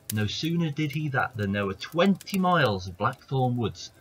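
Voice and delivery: ordinary delivery, nothing marked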